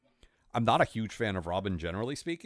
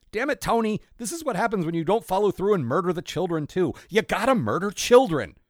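higher and louder, exasperated; mock outraged rant; 8 semitones higher, 5 dB louder than usual. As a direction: scold the hitman in mock outrage. Sarcastic rant as the mob.

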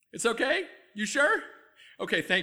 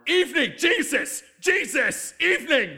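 frustrated outburst; 8 semitones higher, 7 dB louder than usual. Acted. Frustrated self-correction outburst.

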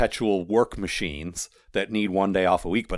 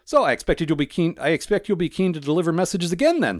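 high-pitched, overdone cheeriness; forced jolly tone, pitched well up; jovial; 9 semitones higher, 4 dB louder than usual. A narrator's reading. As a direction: chirp it too jovially. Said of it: "far more jovially".

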